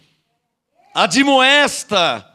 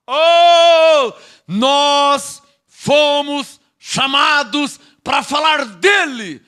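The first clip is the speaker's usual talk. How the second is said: slow shout, every word stretched; loud "oh", then long-held words; drawn-out pulpit cry; 3 semitones higher, 3 dB louder, much slower than usual. Real, not acted. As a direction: proclaim it slowly at full pulpit force. Drawn-out pulpit exclamation to congregation.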